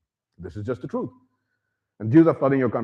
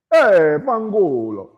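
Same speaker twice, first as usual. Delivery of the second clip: mock Italian greeting; 5 semitones higher, 5 dB louder, much slower than usual. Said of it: "Just start off with a bad term".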